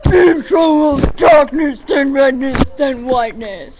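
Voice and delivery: loud, slow, booming impression; Tobuscus. Shown as a demonstration. Friend shows off an impression, loud slow mock delivery. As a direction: belt it out slowly in an exaggerated voice. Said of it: "I can do a tobuscus".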